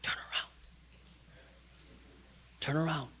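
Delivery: soft, hushed call; God still whispering to the hopeless. Acted. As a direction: whisper the plea to come back. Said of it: "he's still whispering to you".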